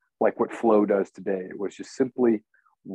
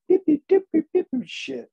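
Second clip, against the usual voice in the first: high piping beeps like a bleep tone; mock censor bleep; 3 dB louder than usual.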